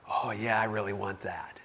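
breathy covetous whisper; tempted whisper. Acted. Eve whispering her desire for the fruit, hushed. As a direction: whisper it like craving forbidden fruit.

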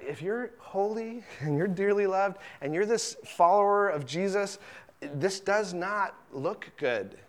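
hushed, gentle fatherly rebuke; soft tender correction. Retold or imitated. God gently telling his beloved children to let go of rage, softly. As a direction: coax them gently to take it off. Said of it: "He says".

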